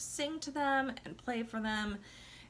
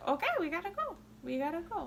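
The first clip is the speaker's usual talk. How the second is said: higher, quick eager agreement; eager compliance; 8 semitones higher, faster than usual. Retold or imitated. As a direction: instantly go along, happy to obey. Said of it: "I'd be like".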